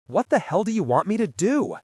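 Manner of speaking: husband raging back at a desperate ally. Acted. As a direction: fling it back in despair. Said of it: "Greg raged back in desperation".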